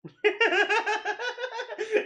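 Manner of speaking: real high, loud cackle; laughing. Real, not acted. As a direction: burst into loud, helpless laughter. Laughing at the played story.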